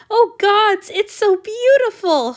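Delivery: high, loud, slow rapture; breathless wonder at the painting; gasping in awe. A narrator's reading. Hanyu seeing his portrait, awed and gasping. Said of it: "Hanyu gasped".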